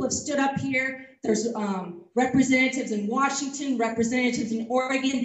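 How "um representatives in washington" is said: ordinary delivery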